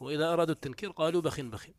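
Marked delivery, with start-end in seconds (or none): none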